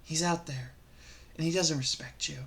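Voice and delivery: very soft, low near-whisper; hushed, low advice to the ladies; mock microphone confidential. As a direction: murmur intimately into a pretend microphone. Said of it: "Let's imagine this was a microphone".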